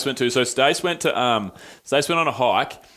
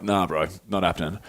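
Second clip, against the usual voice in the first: gruff refusal; 6 semitones lower, 4 dB quieter than usual. Retold or imitated. Soldier refusing, retold.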